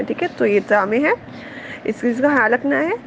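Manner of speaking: usual speaking voice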